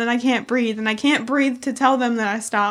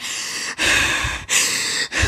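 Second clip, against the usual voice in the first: acted heavy gasping, no voice; mimed breathlessness. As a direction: pant as if too winded to answer. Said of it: "like, I can't even".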